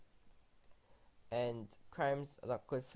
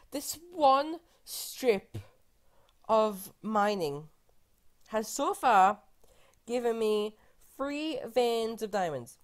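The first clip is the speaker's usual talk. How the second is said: mock exasperation; 7 semitones higher, 9 dB louder, slower than usual. Real, not acted. Exasperated complaint about luck.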